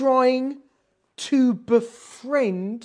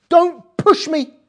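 slow, louder, firm; firm refusal; 6 semitones higher, 8 dB louder, slower than usual. Retold or imitated. Rus firmly refusing Naomi's push. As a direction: plant your feet and refuse. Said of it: "Al tifki vi".

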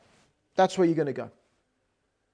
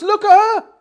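urging; 10 semitones higher, 9 dB louder than usual. Retold or imitated. Naomi points at departing Orpah.

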